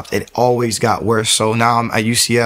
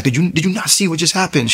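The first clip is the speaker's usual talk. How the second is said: panicked, much higher retold question; freaking out; 7 semitones higher than usual. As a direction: blurt it out in a frightened rush, pitched up. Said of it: "I'm freaking out".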